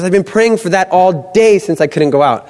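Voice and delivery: high, emphatic amazement; acted excitement. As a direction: retell her thrilled outburst. Retold sister thrilled at answered prayer.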